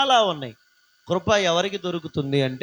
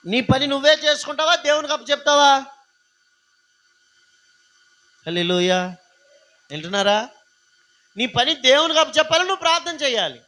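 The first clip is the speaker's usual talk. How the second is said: higher and louder, pressing the church; full-force preaching; 7 semitones higher, 3 dB louder than usual. Real, not acted. Preacher urging the congregation to pray, at full force. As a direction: drive the point home from the pulpit.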